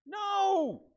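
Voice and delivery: high, plain-hearted "No"; thief's no. Retold or imitated. Thief answers again.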